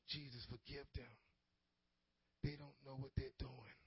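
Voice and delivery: low, soft retold plea for forgiveness; hushed prayer. Preacher voices the girl praying for her killers, hushed prayer. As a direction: voice the girl's prayer quietly, low. Doomed girl's prayer in story.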